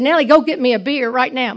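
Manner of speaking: mother in pain yelling for a beer; retold yell. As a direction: bark the order at the kid. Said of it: "so I yelled at Natalie".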